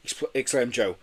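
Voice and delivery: ordinary delivery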